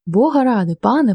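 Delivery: ordinary delivery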